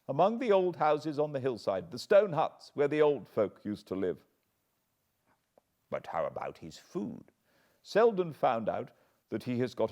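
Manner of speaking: a hint of a nervous old butler; lightly voiced butler. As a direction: answer like a worried servant. Barrymore voiced.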